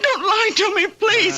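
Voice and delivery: high, tearful plea; pleading. Acted. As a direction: beg him through tears. Daughter begs her father.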